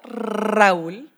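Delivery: Raúl with a long trilled R; rolled R. Real, not acted. Co-host greets partner by name, rolled R. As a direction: roll the opening R of Raúl hard. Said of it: "I love the way you roll the R's".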